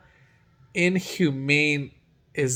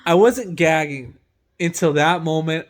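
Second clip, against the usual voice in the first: ordinary talk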